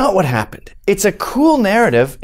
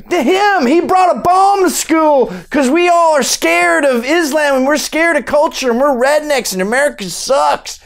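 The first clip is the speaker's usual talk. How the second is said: mock-panicked tattling at a high pitch; hysterical accuser; 9 semitones higher than usual. Acted panicked reply to the police.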